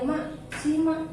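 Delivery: imitating patients calling out, high-pitched; calling her over. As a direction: mimic someone summoning the cleaner. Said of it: "mereka panggil saya".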